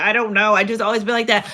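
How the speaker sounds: normal talking voice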